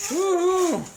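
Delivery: high, loud whoop; excited cheer. Real, not acted. Parent cheering as the packs open, excited cheer. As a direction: let out a high-pitched woo-hoo.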